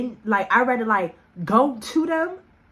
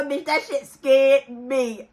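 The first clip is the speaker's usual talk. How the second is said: usual speaking voice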